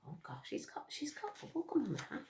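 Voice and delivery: quick, soft, half-whispered aside; hushed aside. Real, not acted.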